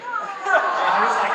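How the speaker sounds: loud, high, drawn-out lead-in; excited build-up. Retold or imitated. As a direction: wind up slowly into a high yell. Builds into an excited retold cry.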